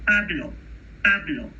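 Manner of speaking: Pablo with no puff of air on the P; unaspirated P. Shown as a demonstration. Teacher demonstrating the Spanish unaspirated P. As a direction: keep the P of Pablo crisp, no breath. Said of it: "You don't hear a puff of air".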